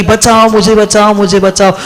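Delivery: mock yell for rescue; storyteller imitating someone yelling for help; retold cry for help. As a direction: call out for help, half full voice. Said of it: "जोर से चिल्लाने लगी".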